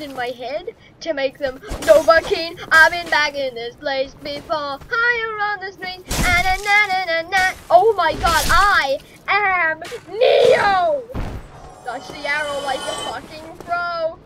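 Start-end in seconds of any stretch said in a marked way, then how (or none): 6.2-11.0 loud, gleeful chant; triumphant. Real, not acted. Gamer dodging arrows, loud gleeful chant. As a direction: crow with delight.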